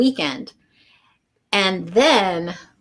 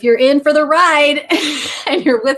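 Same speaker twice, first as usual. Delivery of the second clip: talking through real laughter, pitch climbing; giggly, can barely get it out; laughing; 6 semitones higher, 3 dB louder than usual. Real, not acted. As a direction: laugh the words out, delighted. Laughing while praising the process.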